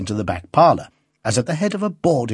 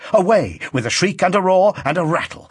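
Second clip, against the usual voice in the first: excited narration; 7 semitones higher, 3 dB louder than usual. A narrator's reading. Train passage rising.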